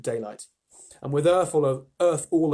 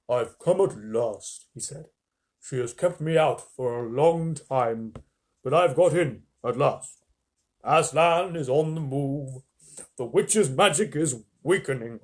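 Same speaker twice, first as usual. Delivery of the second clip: Father Christmas voice; 3 dB louder, slower than usual. Acted. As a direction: speak slowly with grand kindness. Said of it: "What should his voice be?".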